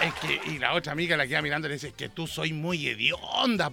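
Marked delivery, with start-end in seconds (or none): 1.9-3.7 slow blunt verdict. Acted. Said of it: "por le dijo".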